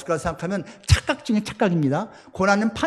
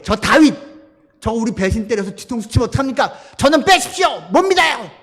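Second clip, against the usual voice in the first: high, loud shouted objection; protesting officers; 4 semitones higher, 4 dB louder than usual. Retold or imitated. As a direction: officers yelling their distrust to the king. 부하들이 왕에게 반대. (왕에게 높고 크게 외치는 반대)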